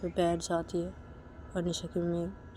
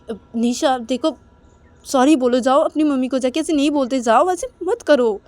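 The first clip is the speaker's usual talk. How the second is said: loud, raised-pitch urging; insistent pleading; 5 semitones higher, 11 dB louder than usual. Acted. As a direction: press her loudly to go and apologise. Manisha माफ़ी के लिए मनाती है. (ज़ोर देकर ऊँची आवाज़ में मनाते हुए)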